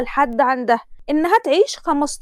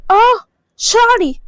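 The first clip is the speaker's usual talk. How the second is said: higher, slow startled ouch; pained yelp; 9 semitones higher, 6 dB louder, slower than usual. Acted. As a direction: cry out as your hair is yanked. Hair pulled by groom's mother. (صرخة ألم خفيفة من شد الشعر)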